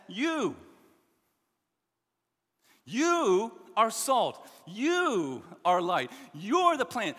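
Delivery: "you" punched loud and slow each time; emphatic you. Shown as a demonstration. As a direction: lean hard on every "you". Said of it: "The pronoun here is emphatic".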